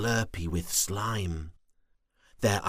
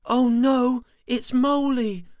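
high, loud worried warning; 9 dB louder than usual. Acted. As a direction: warn her brother in alarm. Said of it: "Twirly Worm warned".

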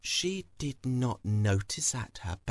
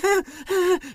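high squeaky laugh; nervous giggle; 8 dB louder than usual. Acted. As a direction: giggle guiltily after a weak excuse. Thief's nervous laugh after excuse.

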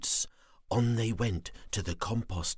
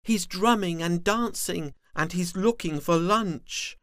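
high, louder little worm; small worm voice; 9 semitones higher, 4 dB louder than usual. Acted. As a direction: explain like a big sister worm. Sister worm explains.

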